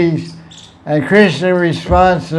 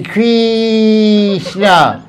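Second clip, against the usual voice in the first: put-on "Krishna" in Italian accent, hugely drawn-out "Kreee"; 3 semitones higher than usual. Teasing how they chant in Italy, exaggerated Italian accent. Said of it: "in Italy, they say Krishna".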